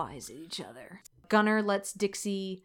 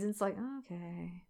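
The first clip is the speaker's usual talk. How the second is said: ordinary talk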